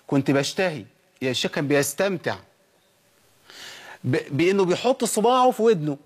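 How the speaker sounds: normal talking voice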